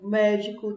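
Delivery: "médico" with a loud, punched MÉ; stress on antepenultimate syllable. Shown as a demonstration. Teacher showing pupils the strong syllable, loud MÉ in "médico". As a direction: hit the MÉ of "médico" hard. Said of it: "a antepenúltima é a mais forte".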